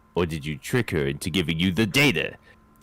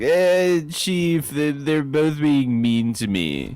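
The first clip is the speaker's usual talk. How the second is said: higher, whiny tattletale voice; mock whiny complaint; 6 semitones higher, slower than usual. Player imagines whining to the chief in a higher, whiny voice. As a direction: whine to the boss like a wronged kid.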